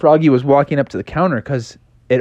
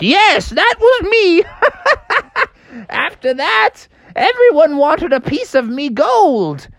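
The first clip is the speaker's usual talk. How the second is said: put-on high, proud old miner; old prospector. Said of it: "And he's like".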